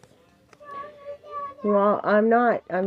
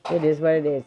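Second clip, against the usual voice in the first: normal talking voice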